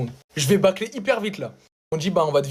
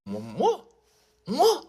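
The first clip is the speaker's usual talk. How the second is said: mock surprise; 8 semitones higher, much slower than usual. Feigned innocence, surprised.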